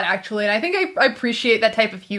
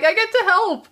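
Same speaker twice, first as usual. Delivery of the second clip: higher-pitched eager helper; excited; 9 semitones higher than usual. Retold or imitated. Fan quoting Pinky's delight at helping Brain, excited. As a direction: eagerly imitate a thrilled helper. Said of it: "so excited about it".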